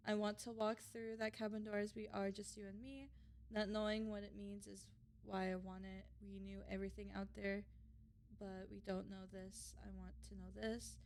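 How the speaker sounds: soft, hushed and sincere, like a quiet vow; tender hero lines. Acted. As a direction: speak it gently to the princess, close and low-key. Reads hero's tender choice lines.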